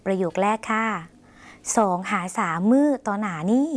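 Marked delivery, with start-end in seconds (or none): 1.3-3.7 slow, clear Lao model sentence สองหาสามมื้อต่อหน้านี้; slow Lao demo. Shown as a demonstration. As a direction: spell it out slowly for learners to repeat. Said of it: "ลองออกเสียงภาษาลาว". (อ่านช้าๆ ชัดๆ ให้ผู้ชมพูดตาม)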